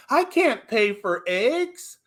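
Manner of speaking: a lightly put-on wife fretting over prices; mock complaining wife. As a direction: wives at the grocery store, mildly whining. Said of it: "their wives are ... going".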